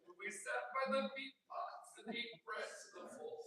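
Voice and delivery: laughing mock Yiddish accent; half-hearted Yiddish accent. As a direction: try the verse in a Yiddish accent. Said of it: "do it in a Yiddish accent".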